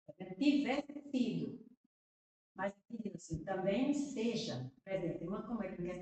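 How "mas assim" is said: usual speaking voice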